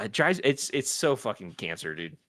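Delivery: usual speaking voice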